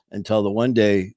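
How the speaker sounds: ordinary delivery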